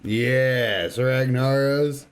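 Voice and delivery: ordinary talk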